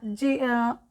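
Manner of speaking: normal talking voice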